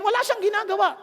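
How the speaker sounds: normal talking voice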